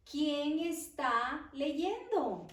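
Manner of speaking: very slow and deliberate, each word stretched; slow dictation. Shown as a demonstration. Teacher dictating a question to students, very slow. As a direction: let students write along.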